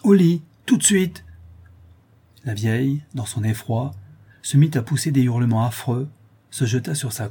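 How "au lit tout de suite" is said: loud, high threatening command; menacing. A narrator's reading. Man cracking whip beside old woman, menacing. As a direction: bark it as a final warning. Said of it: "d'une voix menaçante".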